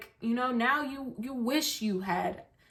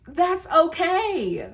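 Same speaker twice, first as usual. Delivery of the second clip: sassy upbeat; 8 semitones higher, 5 dB louder, much slower than usual. Real, not acted. Defiant upbeat turn.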